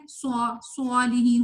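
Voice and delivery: rounded lips turning ص into "swa" in صالحین; wrong rounded reading. Shown as a demonstration. Teacher imitating people's mistake, rounded "swa" in صالحین. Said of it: "ہونٹوں کو گول کر کے".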